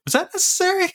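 very high, disbelieving question; incredulous squeak. Retold or imitated. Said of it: "and I was like".

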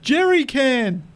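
slow, high mock shout of Jerry can; mock yelled punchline. Dad joke punchline answered from the crowd, hammed-up slow mock yell. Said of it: "someone yells out".

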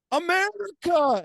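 high, loud, drawn-out battle cry; retold patriotic war cry; imitated yell. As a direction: holler it long and high like a shot call. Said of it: "Emma yelled".

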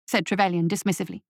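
ordinary delivery, nothing marked

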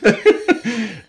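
quick high laugh; short laugh. Real, not acted. Laughing after joking about his tiny department. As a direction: laugh at your own punchline.

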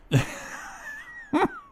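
high, shrill burst of laughter; shrieking laugh. Real, not acted. Howls at the joke.

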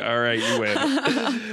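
high, slow, laughing mock surrender; husband giving in. She voices her friend's thin husband conceding, high, slow and laughing. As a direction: give in meekly while laughing. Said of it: "He's like".

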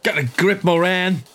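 high-pitched, exasperated callout; mock scolding. Player ribbing the confused Moran, high mock scolding. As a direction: scold him in a high, hammed-up voice.

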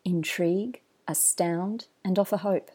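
polished announcer read over music; smooth, measured podcast host intro. Acted. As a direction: draw the listener in like a show opener.

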